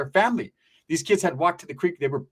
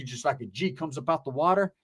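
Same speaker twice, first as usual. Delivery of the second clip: ordinary talk, nothing marked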